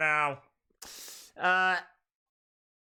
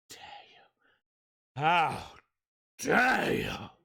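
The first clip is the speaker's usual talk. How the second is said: drama queen. Pretend. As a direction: play the outraged drama queen. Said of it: "Drama Queen days".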